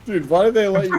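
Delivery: loud, high-pitched words through real laughter; cracking up. Real, not acted. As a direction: burst out laughing as you talk. Cracking up while talking to friends.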